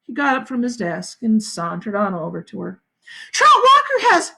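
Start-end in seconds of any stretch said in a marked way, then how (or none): 3.3-4.3 high, loud, urgent; urgent shout. A narrator's reading. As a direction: blurt it out in alarm. Catherine urgent.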